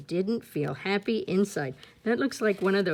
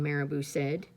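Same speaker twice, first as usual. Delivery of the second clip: usual speaking voice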